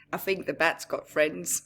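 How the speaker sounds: plain reading voice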